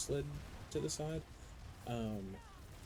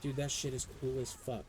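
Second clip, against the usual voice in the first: ordinary delivery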